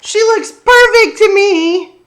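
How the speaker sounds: put-on high, loud child's voice defending her friend; indignant child. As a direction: protest in a bright high kid voice. Sophie defends Bernice to dad.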